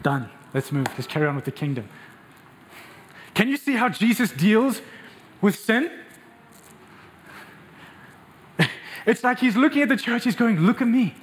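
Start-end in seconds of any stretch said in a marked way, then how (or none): 3.3-11.2 full-force preaching. Acted. Pastor preaching passionately to the congregation. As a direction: preach it hard to the whole church.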